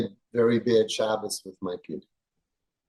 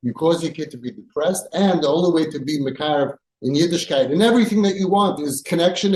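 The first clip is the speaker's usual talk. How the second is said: ordinary talk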